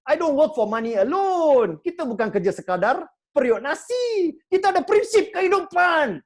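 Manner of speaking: loud, rising declaration of principle; defiant speech about principles, ending in a shout; passionate insistence. Retold or imitated. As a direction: proclaim it like a creed, building to a shout. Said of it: "I say".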